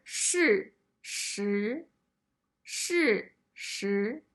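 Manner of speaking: 事实 with a falling then rising tone; 事实 said shì shí, fall then rise; Mandarin tones. Shown as a demonstration. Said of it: "So together".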